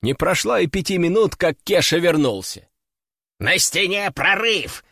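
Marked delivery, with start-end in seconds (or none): 3.4-4.8 slow, urgent parrot report. A narrator's reading. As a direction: report the breach at once. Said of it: "сходу доложил он".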